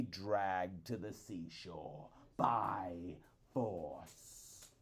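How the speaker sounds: slow threatening whisper; wife whispering a threat; menacing whisper. A narrator's reading. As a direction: hiss the threat low and slow. Wife's threat to husband.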